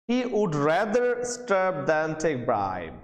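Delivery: ordinary talk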